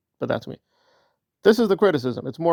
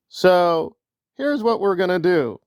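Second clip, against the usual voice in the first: ordinary delivery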